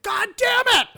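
high, loud mock yell; cursing game enemy. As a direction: curse at full volume like an over-the-top game character. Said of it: "one dude is like".

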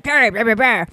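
garbled back-of-the-throat Scottish imitation; mock a thick, incomprehensible Scottish accent; unintelligible Scottish. Niece imitating her Scottish relative handing over coins, garbled Scottish. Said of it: "in the back of his throat kinda talking".

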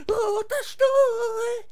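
very high, slow, loud wail; drawn-out high wailing cry; wailing climax. Acted. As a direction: let the chant burst into a howl. Chant peaks in a wail.

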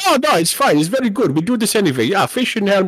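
high-pitched, hammed-up Swedish accent; mock Swedish accent. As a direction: wave it away as a cartoon Swede. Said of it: "whatever the fuck the Swedish people eat".

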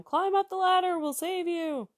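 high, called-out rescue announcement; announcing. Acted. Helicopter crew to a man adrift, announcing a rescue. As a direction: call it out from the helicopter. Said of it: "they're announcing".

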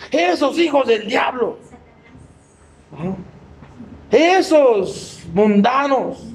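slow, drawn-out scornful preacher; mock judgmental pastor. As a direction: sneer it slowly like a self-righteous pastor. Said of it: "he oído pastores hablar así". (pastor juzgón imitado, lento y despectivo)

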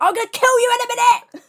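high, loud exasperated yell; frustrated screamed threat; furious shout. Retold or imitated. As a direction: explode at the teacher. Acting out outburst at teacher.

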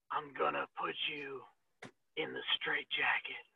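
very soft, low, slow menace; quiet, low wrestler threat with long pauses; Karrion Kross. Acted. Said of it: "Karrion Kross".

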